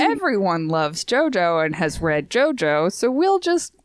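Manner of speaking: breezy mock shrug. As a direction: draw it out like a casual shrug. Mock voice of the show's makers.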